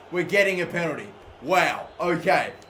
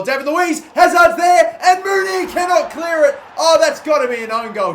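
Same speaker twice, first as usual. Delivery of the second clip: rising commentator shout at the goal; excited goal call; 11 semitones higher, 10 dB louder than usual. Real, not acted. Gamer shouting commentary as the goal goes in, excited.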